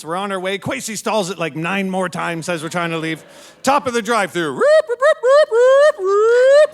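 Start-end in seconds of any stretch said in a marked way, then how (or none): 4.5-6.7 high, loud whooping siren wail; vocal cop siren whoop; siren imitation. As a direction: mimic a police siren.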